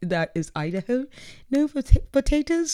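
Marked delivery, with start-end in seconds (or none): 1.5-2.7 high, loud excited cry of potatoes; mock yell of potatoes. Echoing a listener's tweet, a high loud playful yell. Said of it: "I just yelled potatoes".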